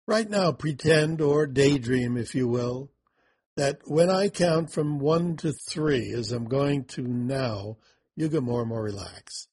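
ordinary delivery

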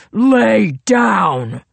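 loud barked command; booming snarl. A narrator's reading. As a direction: bark the order at her. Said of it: "he snarled".

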